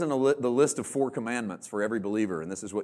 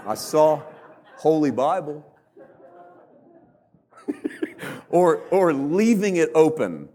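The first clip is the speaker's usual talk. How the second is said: ordinary talk, nothing marked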